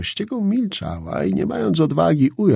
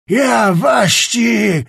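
higher, very slow yell, "Ja" stretched and hit hard; louder furious shout; 8 semitones higher, 6 dB louder, much slower than usual. A narrator's reading. Czapliński escalating at Skrzetuski, higher louder furious shout. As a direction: top the first cry, "Ja waści!" screamed higher. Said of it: "puszczał tylko urywane wyrazy".

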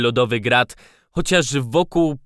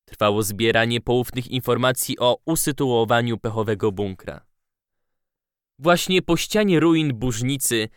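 ordinary delivery

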